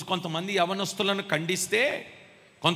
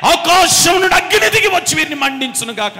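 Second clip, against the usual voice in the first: prophet's command; 9 semitones higher, 12 dB louder than usual. Retold or imitated. Prophet on the mountain calling fire on soldiers, commanding shout. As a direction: call down fire with full authority. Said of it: "మరలా అంటున్నాడు".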